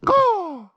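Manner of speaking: single loud, high caw; crow call. Shown as a demonstration. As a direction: bark one caw up at the circling crow. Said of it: "crow call worked".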